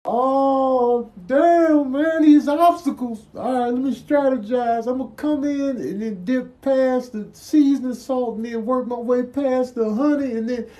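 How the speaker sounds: high-pitched voice planning past obstacles; nervous strategist. Acted. Contestant plotting a way past the seasoning salt, nervous. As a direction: talk yourself through the obstacle course, high.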